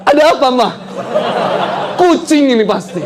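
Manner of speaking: high-pitched, hammed-up answer; mock innocent husband. Husband covers up his theft to wife, high mock-innocent call.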